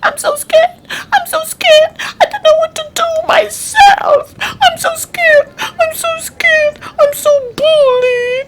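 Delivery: high, tearful mock panic; terrified wailing. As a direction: wail like a bullied little creature. Frightened bullied animal voiced.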